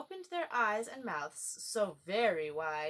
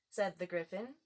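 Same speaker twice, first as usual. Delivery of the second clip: plain reading voice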